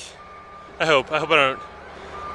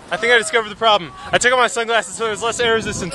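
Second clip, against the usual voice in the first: normal talking voice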